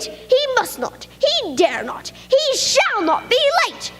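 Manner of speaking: hammed-up angry worm; yelling. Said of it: "The worm, he was yelling".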